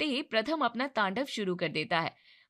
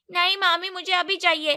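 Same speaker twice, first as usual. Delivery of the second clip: put-on child insisting; 8 semitones higher, 8 dB louder than usual. A narrator's reading.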